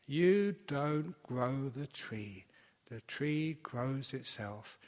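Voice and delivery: very slow, each word laid down; slow down to drive the point home; slow emphatic teaching. Shown as a demonstration. Teacher to meditators, slow and emphatic. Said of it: "slow emphatic teaching".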